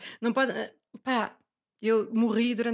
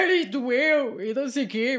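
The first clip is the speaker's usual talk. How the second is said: mock whine, a bit higher; whiny complaint; 3 semitones higher, 4 dB louder, slower than usual. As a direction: moan it like a drama queen. Mocking her own complaining. (queixinha fingida)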